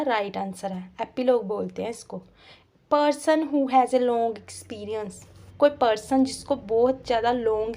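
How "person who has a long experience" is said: each word read out very slowly; slow, deliberate reading of the question; slow dictation. Shown as a demonstration. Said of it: "teacher reads question slowly".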